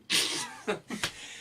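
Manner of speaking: breathy laugh. Real, not acted. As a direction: laugh under your breath. Laughing at partner's eight.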